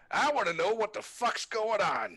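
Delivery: put-on high, loud cranky old-man demand; hammed-up cranky old man; grumpy old man. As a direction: bark it like an irritable old captain. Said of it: "and he goes".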